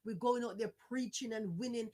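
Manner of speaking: ordinary delivery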